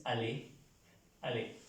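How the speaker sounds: "ale" with a plain L, no y sound; French aller as "a-LÉ", silent r; exception demonstrated. Shown as a demonstration. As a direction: model "ale" twice as a-LÉ. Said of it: "aquí hay excepciones".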